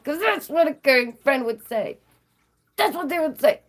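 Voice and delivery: high, pointed mock agreement; sarcastic insistence. Real, not acted. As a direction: pitch it up with heavy sarcasm. Sarcastic insistence about caring friend.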